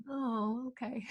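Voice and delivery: soft, falling okay; deflated reply. Retold or imitated. Told off by the friends, a soft deflated okay. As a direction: let it drop away quietly. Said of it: "And I'm like".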